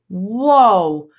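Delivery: startled whoa at reckless driving; shocked whoa. Retold or imitated. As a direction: react like you just saw a near crash. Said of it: "And I was like".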